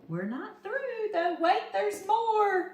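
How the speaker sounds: high, slow, drawn-out infomercial hype; TV commercial pitch. Pretend. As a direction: tease it like a TV ad announcer. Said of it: "Isn't that how those commercials go?".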